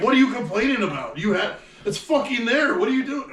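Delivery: high, incredulous outburst; exasperated. Real, not acted. Ranting at the clueless character, exasperated. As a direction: throw up your hands in disbelief.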